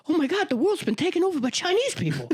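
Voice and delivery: high, laughing [name] impression. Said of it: "we all did [name] impressions".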